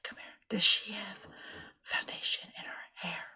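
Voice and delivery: hushed whisper; husband whispering at the front desk. Retold or imitated. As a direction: whisper it so she can't hear. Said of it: "I'm whispering".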